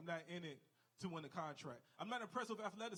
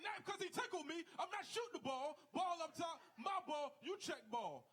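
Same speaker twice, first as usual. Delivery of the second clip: high-pitched mock shout; 9 semitones higher than usual. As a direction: scream your foul call over everyone. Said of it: "You're like".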